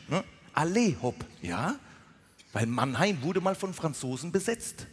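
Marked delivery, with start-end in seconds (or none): 0.5-1.2 "Allez" said "a-LAY", then "Hopp"; French pronunciation. Shown as a demonstration. Comedian explaining the French origin, "Allez" ending in a long ay. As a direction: stress the long final ay of "Allez" before "Hopp". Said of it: "Es kommt aus dem Französischen".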